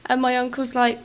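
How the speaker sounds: ordinary delivery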